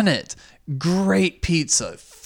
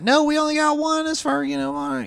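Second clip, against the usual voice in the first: a lightly done kitchen worker trailing off; mock kitchen worker; 13 semitones higher than usual. Imitating the kitchen staff answering the clerk, high and fading.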